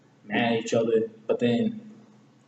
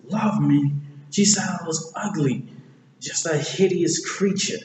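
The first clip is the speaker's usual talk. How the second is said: raised, mildly indignant mock voice; Shrek's protest; 6 semitones higher than usual. Voicing Shrek disbelieving he is loved, mild protest. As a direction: protest it a bit higher, in character.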